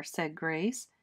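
ordinary delivery, nothing marked

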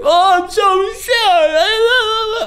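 high, slow, fake-sobbing crybaby; mock crying. Streamer mocking oversensitive people with fake sobbing. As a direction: wail it like a fake crybaby, drawn out. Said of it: "They're like".

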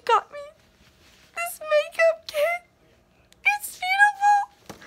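high, slow, gushing swoon; swooning delight. Real, not acted. Vlogger coos over the makeup kit from Michaela, swooning. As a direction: coo over it like a treasure.

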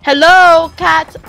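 loud call to the cats; loud, drawn-out annoyed greeting. Real, not acted. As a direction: holler to get their attention. Calling the cats to come.

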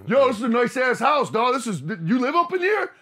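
loud, high swaggering home intruder; cocky intruder voice. Retold or imitated. Storyteller voicing a cocky stranger admiring the house, loud and high. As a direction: barge in like you own the place.

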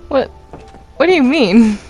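ordinary talk, nothing marked

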